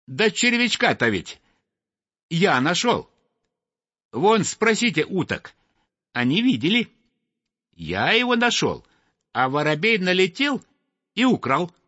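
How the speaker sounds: small high voice, indignant; little snipe protesting. Acted. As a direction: plead your case like a wronged youngster. Little snipe pleads his case.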